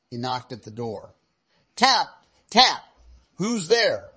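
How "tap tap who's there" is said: higher, louder tap tap; lightly voiced knock and call. A narrator's reading. Wolf knocking at the door.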